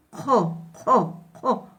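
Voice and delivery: jo breathed out strongly; breathy aspirated jo. Shown as a demonstration. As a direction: push air out on jo. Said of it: "Botamos el aire hacia afuera".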